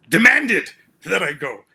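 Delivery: slow, loud, punched delivery; emphatic. Retold or imitated. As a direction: lean hard on demanded. Father's insistence told emphatically.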